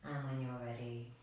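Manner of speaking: soft, unhurried meditation voice with long pauses; guided meditation. Real, not acted. Yoga teacher guiding extended exhalations, soft meditation voice. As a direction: lead them quietly toward stillness.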